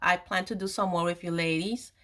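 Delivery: usual speaking voice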